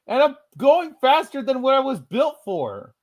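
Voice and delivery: very high-pitched runaway engine; excited alarm. Pretend. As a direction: wail it high in playful panic. Runaway engine roleplay at top speed.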